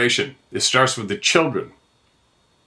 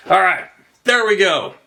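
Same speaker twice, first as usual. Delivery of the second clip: loud reset; 9 semitones higher, 4 dB louder than usual. Real, not acted. Shaking off the anger.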